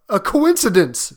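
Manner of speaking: put-on flustered old man; stammering. A narrator's reading. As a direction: stammer out an uneasy excuse. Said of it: "stammered the old man".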